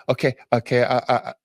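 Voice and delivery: imitating a flustered dad's stammer; mock nervous. Dad returns to take the picture, mildly nervous stammer. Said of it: "the most nervous thirty-year-old man".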